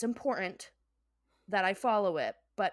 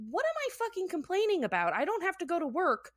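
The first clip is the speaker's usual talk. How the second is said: high, lilting self-rebuke; retold gleeful realisation; 9 semitones higher than usual. Realizing there is no work to go to, retold glee. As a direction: act out a sudden happy change of mind. Quotes own happy realisation.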